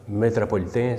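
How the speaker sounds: usual speaking voice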